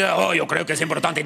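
imitation deep-voiced Spanish dubber; mock Spanish TV dub in a manly voice; deep dubbing voice. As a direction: put on a dubbed leading-man voice. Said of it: "a guy with a very deep".

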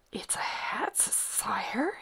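soft, whispery faltering answer; hushed, nervous stammer. A narrator's reading. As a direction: stumble timidly over the words. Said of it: "he stammered".

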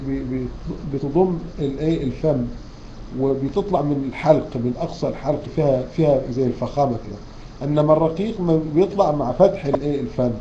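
ordinary talk, nothing marked

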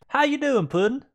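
high, loud sing-song greeting; playful greeting. Pretend. Greeting his own fighter, high, loud and playful. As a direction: sweet-talk him up high and loud.

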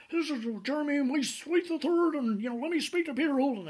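high, loud, put-on pompous lawyer voice; mock posh caller, pitched high; a caller's high voice. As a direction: ham up a self-important lawyer. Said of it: "and he goes".

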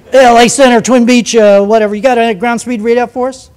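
loud, clipped pilot radio call; imitating a twin-engine pilot on the radio. Pilot calling Center on radio.

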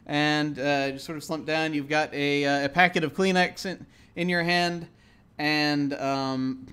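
ordinary talk

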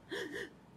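high sobbing gasp; crying gasp. Acted. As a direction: catch a breath between sobs. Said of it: "he cried".

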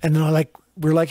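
ordinary talk

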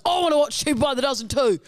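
put-on eager child joining a sibling's plea; Jarch. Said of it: "And then Jarch goes".

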